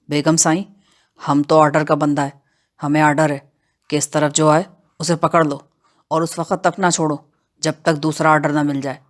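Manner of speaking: low, unhurried voice of a rustic outlaw; matter-of-fact henchman, low-voiced; brazen bandit henchman. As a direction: pitch it down as a bandit under orders. Bandit Bakhshu speaks in the story.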